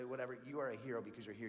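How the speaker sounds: usual speaking voice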